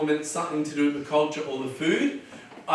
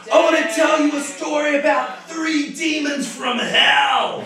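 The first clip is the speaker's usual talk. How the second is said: loud, high, laughing story opener; spooky street shout; 8 semitones higher, 3 dB louder than usual. Shown as a demonstration. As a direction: announce the demon story to the street crowd.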